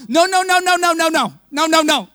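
wife protesting in a shouted no; mock yell. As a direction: mom yelling at the ride. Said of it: "She began to yell".

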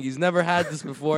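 ordinary talk, nothing marked

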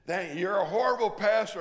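imitating a shrill complaining woman; scolding lady. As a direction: voice the indignant churchgoer, loud and high. Lady scolding pastor on phone.

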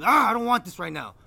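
high, loud exasperated groan into words; mock upset. Imitating friend refusing any consolation, loudly upset. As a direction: act out his overblown refusal. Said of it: "that upset".

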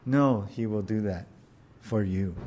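usual speaking voice

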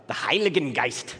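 harsh mock German accent on Heiligen Geist; lightly hammed German, harsh consonants; playful bad German pronunciation. Shown as a demonstration. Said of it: "do a very bad German accent and make it very harsh".

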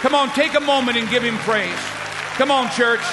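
high, loud fervent exhortation; rally the whole room to praise; preaching at full force. Acted. Pastor urging the church to thank God, full-force preaching.

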